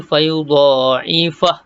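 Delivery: slow recited fayudha'ifah with final h stopped; Quranic recitation. Shown as a demonstration. Teacher demonstrating the pausal form slowly. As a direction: stretch fayudha'ifah slowly, end silent on h.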